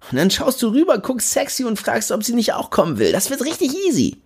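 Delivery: cocky friend, pushy and carefree; Jimmy Blue voice. Acted. Jimmy pitching his plan.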